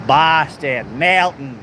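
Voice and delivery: local Boston-area accent, drawn-out vowels; stretched Massachusetts vowels. Shown as a demonstration. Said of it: "how they say it with the accents here".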